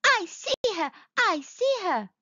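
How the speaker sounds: high-pitched, eager little cry; excited little mole. A narrator's reading. Little mole answers mamma, crying out high and eager. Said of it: "cried Little Mole".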